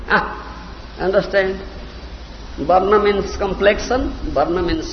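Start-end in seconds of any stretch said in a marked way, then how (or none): none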